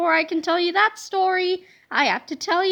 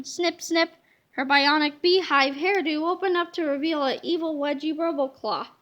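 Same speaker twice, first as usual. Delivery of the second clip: ordinary delivery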